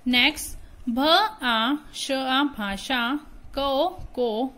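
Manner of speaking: lively classroom teacher voice; teacher reading lesson. Acted. Teacher addresses pupils with lively classroom voice. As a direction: teach young pupils brightly.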